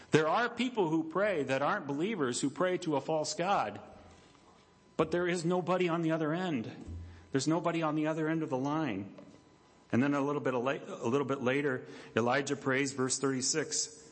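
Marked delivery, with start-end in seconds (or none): none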